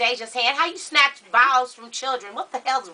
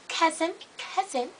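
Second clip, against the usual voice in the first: put-on proper little girl; 5 semitones higher, 7 dB quieter than usual. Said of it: "'cause she's proper, and she talks like that".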